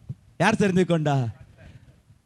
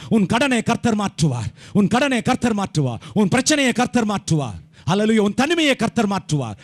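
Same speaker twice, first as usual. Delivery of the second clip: rhythmic declarations, building higher; preaching litany; 3 semitones higher, 4 dB louder than usual. Real, not acted. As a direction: proclaim each promise forcefully, climbing in pitch. Preaches a rising litany of promises. (அடுக்கடுக்காக உரக்க அறிவிக்கும் பிரசங்கம்)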